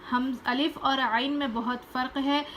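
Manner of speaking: ordinary delivery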